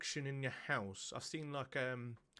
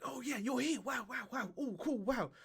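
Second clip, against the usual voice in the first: much higher, eager gushing dog voice; excited dog; 11 semitones higher than usual. Retold or imitated. Voicing a dog greeting its owner, gushing high and eager. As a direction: gush like a thrilled puppy. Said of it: "dogs are like".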